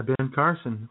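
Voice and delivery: ordinary delivery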